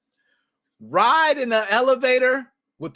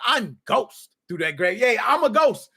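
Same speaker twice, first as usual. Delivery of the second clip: ordinary talk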